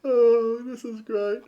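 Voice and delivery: high, loud mock delight; sarcastic. Real, not acted. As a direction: fake cheer at the dead air. Sarcastic reaction to stalling.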